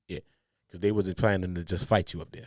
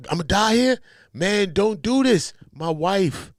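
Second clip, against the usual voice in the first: high, loud begging; mock pleading; 9 semitones higher, 6 dB louder, slower than usual. Imitating Wack begging for wife and kids, mock pleading. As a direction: plead as if for your life, hammed up. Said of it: "Wack pleaded and said".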